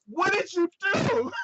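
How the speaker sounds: high, slow, loud horrified outcry; appalled shout. Retold or imitated. Android reacting to the rude password with an appalled shout.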